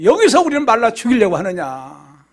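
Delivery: loud, acted cry of grievance; resentful complaint. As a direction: protest loudly like an aggrieved crowd. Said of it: "막 원망한 거예요".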